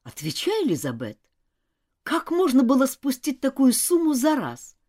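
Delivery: exasperated telling-off, building in force; growing anger. Acted. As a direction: demand an answer, getting cross. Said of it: "Нора начала злиться".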